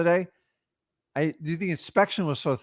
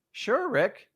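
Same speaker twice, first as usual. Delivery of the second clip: seller agreeing; 9 semitones higher, 4 dB quieter than usual. Acted. Seller agreeing in role-play.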